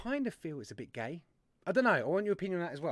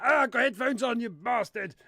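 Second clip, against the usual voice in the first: high, loud mock-angry yelp; mock outrage; 6 semitones higher, 6 dB louder than usual. Host mimics viewers blasted by the jingle, mock-angry yell. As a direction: play the deafened viewer, pitched up and loud.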